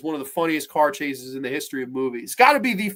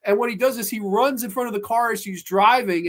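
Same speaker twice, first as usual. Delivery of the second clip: usual speaking voice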